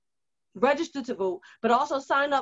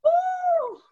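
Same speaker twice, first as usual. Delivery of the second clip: emotional woo; 3 dB louder than usual. Real, not acted. Overwhelmed by emotional video.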